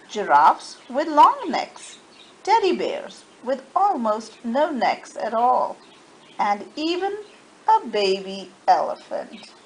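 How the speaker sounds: ordinary delivery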